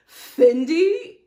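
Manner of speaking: loud, rising, baffled question; Sutton. Retold or imitated. Sutton questioning Dorit about the designer, incredulous. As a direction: query it with mock disbelief. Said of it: "So she goes".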